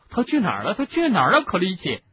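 rising to a high, loud demand; urgent shouting. Acted. Harry demands, building.

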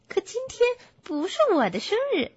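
high, quick bear voice; puzzled little bear. Acted. Bear says it isn't his birthday, puzzled.

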